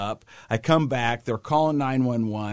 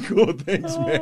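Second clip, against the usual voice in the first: higher words with laughter under them; amused mock thanks through a laugh; 10 semitones higher, 3 dB louder, slower than usual. Real, not acted. Dad thanking his honest son, laughing as he talks.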